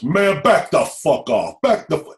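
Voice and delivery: loud, high-pitched yell to back off; gangster's shouted warning. Retold or imitated. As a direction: bellow it to drive a crowd back. Booming gangster orders crowd back.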